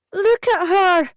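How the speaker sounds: high loud panicked scream. A narrator's reading. Mother thrusting baby at the nurse, screaming. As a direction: hurl it at the nurse. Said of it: "I screamed".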